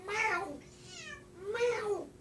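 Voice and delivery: squeaky high meows; high meow imitation. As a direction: call the cat with a meow. Meowing to the cat.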